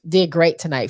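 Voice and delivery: ordinary talk, nothing marked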